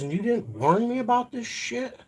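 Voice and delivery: high incredulous accusation; indignant disbelief. Retold or imitated. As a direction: squeak out the betrayal. Retold accusation at mom.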